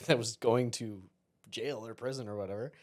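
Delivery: ordinary talk, nothing marked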